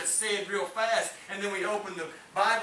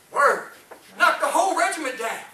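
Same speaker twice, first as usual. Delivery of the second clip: a sudden loud cry of Word!; high, loud preaching burst; preaching shout; 6 semitones higher, 9 dB louder than usual. Acted. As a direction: shout it from the pulpit. Preacher rises to climax.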